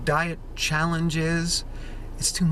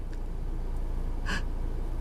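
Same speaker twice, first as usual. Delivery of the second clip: offended gasp; 5 dB quieter than usual. Acted. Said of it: "And the trees are like".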